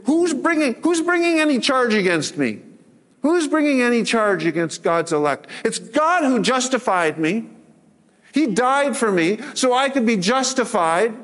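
a believer answering accusations with scripture; preached declaration. Shown as a demonstration. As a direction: proclaim "who's bringing any charge against me" boldly. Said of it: "where you can say".

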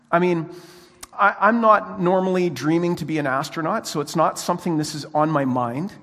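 ordinary delivery

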